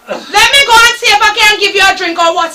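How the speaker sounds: usual speaking voice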